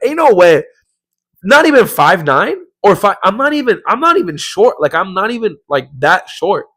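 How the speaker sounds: high-pitched comic disbelief, loud at first; indignant protest. Retold or imitated. Member disputing his reported height, indignant protest. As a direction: protest as if your pride is wounded.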